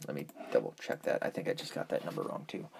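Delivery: fast whispered aside to self; whispering. Real, not acted. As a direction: mutter it under your breath. Muttering while checking number.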